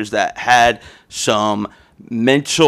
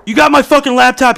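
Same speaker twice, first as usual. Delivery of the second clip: high, loud accusing demand; confronting shout; 13 semitones higher, 6 dB louder, faster than usual. Retold or imitated. Confronting housemate at his door over laptop, high shout.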